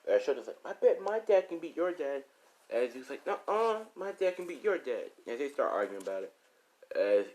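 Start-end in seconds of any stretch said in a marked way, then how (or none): 0.7-2.2 put-on high-pitched kid's brag; boasting child. Shoto boasting to another child, high kid's brag. 3.3-5.1 put-on high-pitched kid's comeback; arguing child. Zeus arguing with Shoto about dads, high childish comeback.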